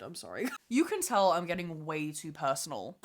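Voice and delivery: ordinary talk, nothing marked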